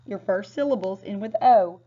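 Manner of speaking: ordinary talk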